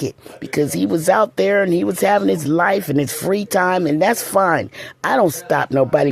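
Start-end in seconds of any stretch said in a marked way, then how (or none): none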